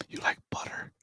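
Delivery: giggling hushed whisper; movie theater whisper. Pretend. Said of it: "you can whisper in the movies".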